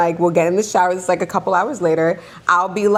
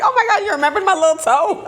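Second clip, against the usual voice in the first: high, laughing disbelief; laughing delight; 10 semitones higher, faster than usual. Retold or imitated. Mom touched that her daughter remembered, laughing and high. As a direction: gush with laughter over being remembered.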